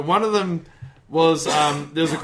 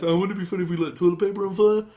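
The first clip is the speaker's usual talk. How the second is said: put-on light French accent, quoting a tourist; 5 semitones higher, faster than usual. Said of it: "in a French accent, not in a dumbass accent".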